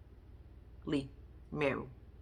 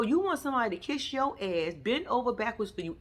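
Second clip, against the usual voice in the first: ordinary talk, nothing marked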